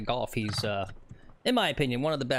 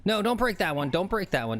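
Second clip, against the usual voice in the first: urging the ball; 7 semitones higher, 3 dB louder than usual. Real, not acted. Urging ball while it rolls.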